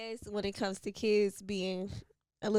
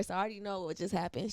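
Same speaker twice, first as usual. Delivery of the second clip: ordinary talk, nothing marked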